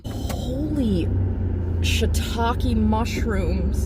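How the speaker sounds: high, drawn-out wail of overwhelm; about to cry. Real, not acted. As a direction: stretch it out, fighting back tears. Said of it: "I'm gonna cry again".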